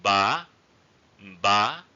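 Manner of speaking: "Ba" rising sharply to a high end. Shown as a demonstration. Teacher gives example words for fifth tone, high rising tone. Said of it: "high raising tone".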